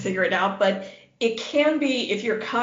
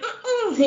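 high-pitched, giggly "mm-mm"; playful refusal hum; 13 semitones higher than usual. Retold or imitated. Woman retells refusing to talk to her date, playful.

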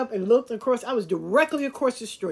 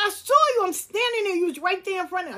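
high, loud, heated accusation; retold furious confrontation, pitched high and loud; accusing shout; 12 semitones higher, 4 dB louder, faster than usual. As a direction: call him out hard, voice shooting up. Insisting she saw him.

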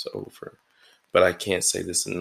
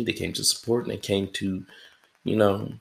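ordinary talk

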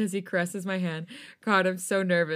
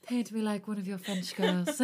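put-on British accent; 4 dB quieter than usual. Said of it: "I don't know if she's British".

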